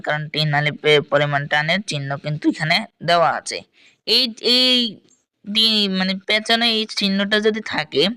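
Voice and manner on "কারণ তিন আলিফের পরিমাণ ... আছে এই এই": normal talking voice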